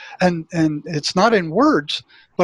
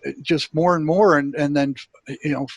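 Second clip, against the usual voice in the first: ordinary talk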